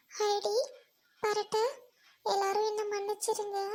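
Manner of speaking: soft, level, halting delivery; subdued, heavy-hearted goodbye; sorrowful farewell. Acted. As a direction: apologize to each friend, defeated. Tearful girl says goodbye to friends.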